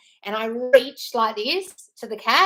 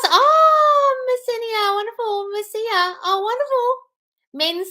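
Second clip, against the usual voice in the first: delighted; 13 semitones higher, 7 dB louder, slower than usual. Real, not acted. Delighted reading viewer name.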